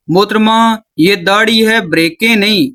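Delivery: normal talking voice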